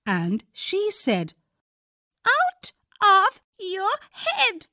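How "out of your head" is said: put-on high, slow child's voice; a small girl making a demand; little girl request. A narrator's reading. Jo asking Daddy to invent the story.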